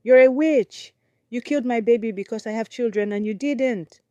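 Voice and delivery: loud, high angry scream; furious accusation. Acted. Wife accusing rival, screaming.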